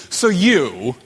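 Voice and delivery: "you" hit hard and loud; stress on YOU. Acted. Pilate to Jesus, avoiding the issue, stress on YOU. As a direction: lean heavily on the word "you". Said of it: "you" is definitely emphatic this time".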